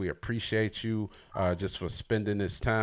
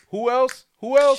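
high, loud hype pressing for more; excited push; 13 semitones higher, 10 dB louder than usual. Real, not acted. Host pressing the guest for more opponents, high excited call. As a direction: keep pushing her, louder each time.

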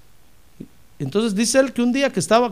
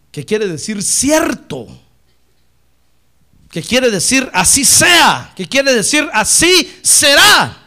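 slow, louder, hammering the meaning; emphatic preaching; 4 semitones higher, 7 dB louder, slower than usual. Acted. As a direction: shout each meaning of amen.

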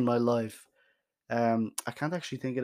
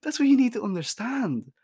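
emphatic; 9 semitones higher, 4 dB louder than usual. Retold or imitated. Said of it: "I'm like".

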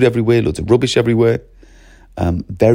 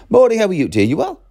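much higher, bright hello to pupils; cheery corridor greeting; 9 semitones higher than usual. Retold or imitated. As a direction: greet every child like an eccentric teacher. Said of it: "mad as a hatter".

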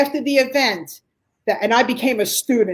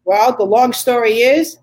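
usual speaking voice

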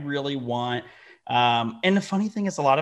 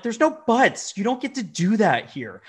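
higher and louder, stern and final; stern scolding; 8 semitones higher, 5 dB louder than usual. Retold or imitated. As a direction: shut down her excuse hard. Retold scolding of employee.

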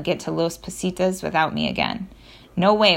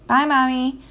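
put-on high, louder child's call; child shouting bye; 6 semitones higher, 4 dB louder than usual. A narrator's reading. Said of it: "Mila shouted".

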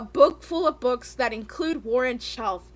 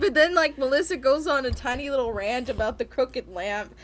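ordinary talk

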